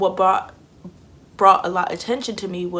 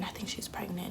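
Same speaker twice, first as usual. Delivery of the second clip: soft hushed whisper; gossipy whisper; 12 dB quieter than usual. Retold or imitated. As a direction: whisper it behind her back. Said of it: "people just whispering".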